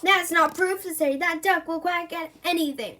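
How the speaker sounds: indignant protest. Acted. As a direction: protest the evidence as the accused. Accused protests the duck's evidence.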